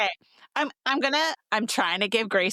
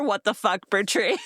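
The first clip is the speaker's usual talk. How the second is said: ordinary talk, nothing marked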